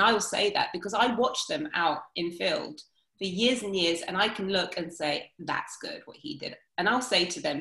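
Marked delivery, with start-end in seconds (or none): 5.4-6.6 a hushed whisper to himself; whispered aside. Retold or imitated. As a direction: whisper it as a private verdict. Quietly judging a trainer's move.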